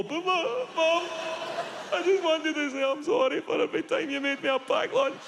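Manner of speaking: put-on high, pleading drunk voice; high teary drunk rambling; drunk son on the phone. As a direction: beg forgiveness down the phone. Drunk son calling mum.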